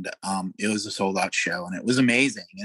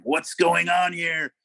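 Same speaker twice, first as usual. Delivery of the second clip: lordly king receiving visitors, higher and slow; drawn-out, raised-pitch kingly demand; 7 semitones higher, 3 dB louder, slower than usual. Retold or imitated. Rock star like a king to people entering, lordly imitation. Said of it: "you know, like a king".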